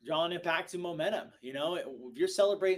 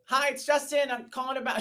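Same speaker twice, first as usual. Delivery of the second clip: mock voicemail greeting; 11 semitones higher, 9 dB louder than usual.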